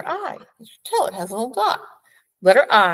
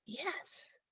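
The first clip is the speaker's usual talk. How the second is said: whisper; 7 semitones higher, 23 dB quieter than usual. Real, not acted.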